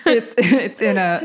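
laughing through the words; giggling while talking. Real, not acted. Laughing at own mistake.

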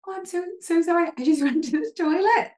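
high, rushed apology acted out with a laugh; flustered apology. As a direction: plead innocence in a mock fluster. Acting out her flustered apology.

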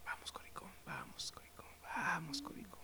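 teasing stage whisper. Acted. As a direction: hurry him along in a whisper. Urging friend to answer, teasing.

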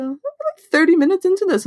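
high-pitched mock bafflement; retold bafflement. Host retelling waiting for Travolta to appear, high-pitched bafflement.

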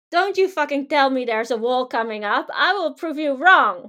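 loud, defiant snarl, slowing on the threat; defiant ego voice. Pretend. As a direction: throw the challenge back hard. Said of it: "it also feels like you have something to prove".

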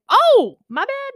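high, loud, drawn-out "oh"; exaggerated apologetic yelp; acted sudden realization. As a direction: act out a slow, loud realization. Retold reaction to the officer.

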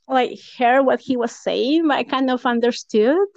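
ordinary talk, nothing marked

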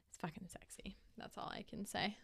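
unvoiced, confiding whisper. Real, not acted. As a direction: lean in and whisper it like a secret. Confiding about the accent.

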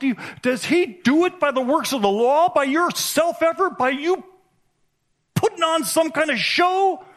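high, incredulous preaching; Paul's rebuke. Retold or imitated. Preacher voicing Paul scolding the Galatians, high and exasperated. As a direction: rain Paul's scolding questions on them. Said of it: "He says in Galatians three".

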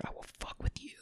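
very soft, retold menacing whisper; imitating a hushed threat.

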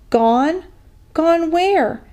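rising to a high, shrill-pitched cry; shrieking. A narrator's reading. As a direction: build from a shocked echo to a high shriek. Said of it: "Jessie was shrieking now".

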